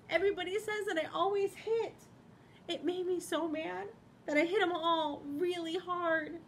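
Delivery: high, upset and teary; tearful Hamster. Acted. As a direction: confess it through tears. Hamster crying, confessing.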